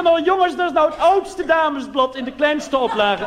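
high, loud, slow punchline; high mock announcement. Comedian telling his tour group, high and loud. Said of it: "Want ik zei".

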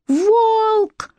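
high, sudden scream waking from a nightmare. A narrator's reading. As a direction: scream the word out of a bad dream. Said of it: "Заорав".

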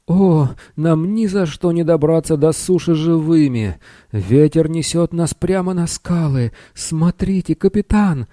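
high-pitched little wizard in alarm; thin, panicked voice. A narrator's reading. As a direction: cry out a warning in a thin little voice. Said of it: "сорвался тоненький голосок волшебника".

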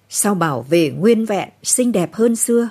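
usual speaking voice

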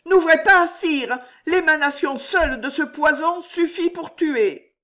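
cri aigu et bien plus fort; hurle-lui de ne pas ouvrir; cri de terreur. A narrator's reading. Terrified warning about poison. (Juliette terrifiée crie au roi de ne pas ouvrir)

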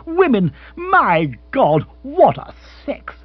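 high, emphatic exasperation; comically bitter lament; bitter exclamation. Acted. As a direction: throw up your hands in scorn. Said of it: "said Bill bitterly".

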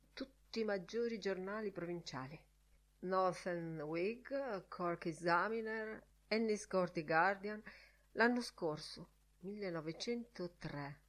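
softly listing, hushed librarian; helpful librarian in a subdued murmur; obliging librarian. Acted. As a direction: recite the list quietly for a library visitor. Librarian lists papers for the visitor.

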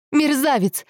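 high-pitched outraged insult; wounded, furious exclamation; angry cry. A narrator's reading. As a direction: hurl the insult at a betrayer. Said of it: "выкрикнула Анжела".

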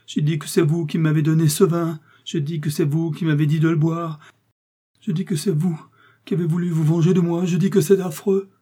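high, insistent, agonised accusation; poisoned man crying out against his killer; dying man's accusation. A narrator's reading. Poisoned dying soldier accuses D'Artagnan.

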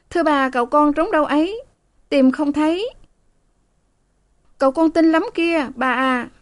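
put-on high, brisk young maid; eager maid. A narrator's reading. Eager maid offers to fetch the young master, high and brisk.

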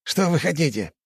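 high-pitched, strained-patience question; barely contained anger. A narrator's reading. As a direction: ask while holding back an explosion of rage. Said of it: "чтобы не взорваться от гнева".

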